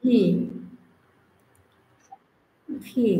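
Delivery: "ভি" with lip-teeth v; buzzing "ভি"; correct V. Shown as a demonstration. Teacher models V as "ভি". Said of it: "এভাবে উচ্চারণ করি".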